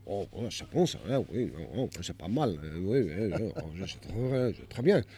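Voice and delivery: low, quiet appreciative French; French accent. Retold or imitated. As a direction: murmur approval in French. Locals tasting, French exclamations.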